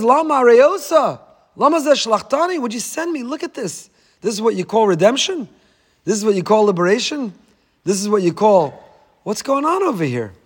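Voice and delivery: high, pleading accusation to God; accusing protest. Retold or imitated. Moshe protests to Hashem, high and accusing. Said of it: "he points an accusing finger".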